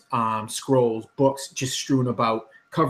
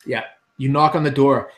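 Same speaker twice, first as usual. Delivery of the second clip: ordinary talk, nothing marked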